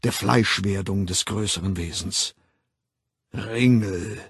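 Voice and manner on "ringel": measured, resonant ritual intonation; booming voice. A narrator's reading. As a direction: boom out each word separately. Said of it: "dröhnte die Stimme".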